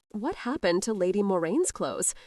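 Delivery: plain reading voice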